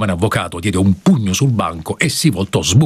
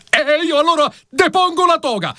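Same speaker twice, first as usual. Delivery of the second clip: much higher, indignant; indignant threat. Acted. Lawyer threatens the judge, high indignant outburst. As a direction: throw down the gown in protest.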